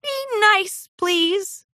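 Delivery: hissed through gritted teeth. A narrator's reading. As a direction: warn him through clenched teeth. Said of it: "through gritted teeth".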